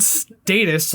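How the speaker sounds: "status" said STAY-tus; "status" with the first syllable like "stay"; long-A status. Retold or imitated. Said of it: "they echoed the word".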